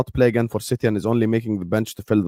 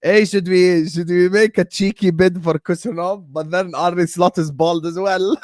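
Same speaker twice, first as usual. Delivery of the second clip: giddy excited; 8 semitones higher than usual. Real, not acted.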